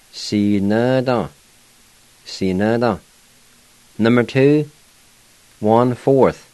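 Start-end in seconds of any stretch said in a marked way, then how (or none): 0.1-1.3 Si ne da said slowly, each syllable given room; slow demo. Shown as a demonstration. Teacher pronouncing Si ne da slowly for students. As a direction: spell Si ne da out slowly for learners. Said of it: "And now I'll go back and pronounce them".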